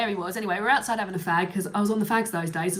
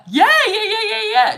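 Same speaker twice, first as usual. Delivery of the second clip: high, loud, excited string of yeses; eager agreement; 12 semitones higher, 9 dB louder than usual. Retold or imitated. Imitating partner keen to swap numbers, excited and loud.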